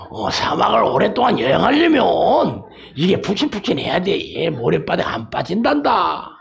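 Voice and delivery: put-on singsong mum explaining to a child; mother camel. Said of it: "낙타 새끼가 엄마한테".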